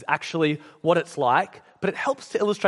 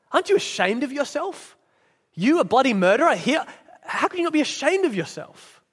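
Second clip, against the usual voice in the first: higher-pitched, scandalised reproach; indignant ghost; 7 semitones higher than usual. Acted. Ghost scolds the murderer now in heaven, indignant. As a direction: accuse with swooping, outraged emphasis.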